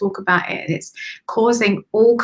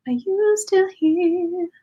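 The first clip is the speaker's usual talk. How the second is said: higher, slow calling tone; lilting higher slow call; sing-song call; 10 semitones higher, slower than usual. Real, not acted. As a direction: call out as if across a room. Calling a viewer in chat.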